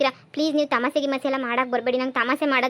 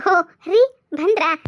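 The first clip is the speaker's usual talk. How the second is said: calling out; 4 semitones higher, 4 dB louder, much slower than usual. Acted. Wife calls to arriving husband.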